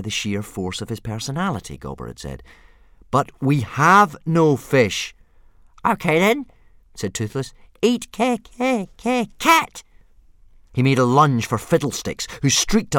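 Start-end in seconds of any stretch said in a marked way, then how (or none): 3.1-5.1 louder, slow and firm; firm but pleasant. Acted. Hiccup trying to dominate his dragon, firm but pleasant. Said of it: "make his voice sound firm but pleasant". 5.9-9.8 much higher, louder squealing dragon; demanding little dragon. Acted. Baby dragon Toothless squealing demands for fish. Dragon stammering about eating cat.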